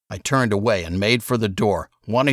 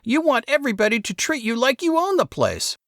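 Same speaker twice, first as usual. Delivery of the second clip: put-on high, resentful kid brother voice; boy Saul, whining. A narrator's reading. Saul snaps at his older brother, high and resentful. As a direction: sneer it like a bratty younger boy. Saul's voice in the story.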